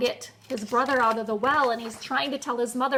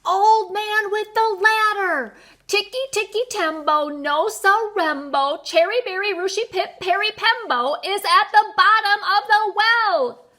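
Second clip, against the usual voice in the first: desperate shout; 9 semitones higher, 5 dB louder than usual. A narrator's reading. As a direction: shout with your last bit of breath. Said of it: "with his very last bit of breath, he shouted".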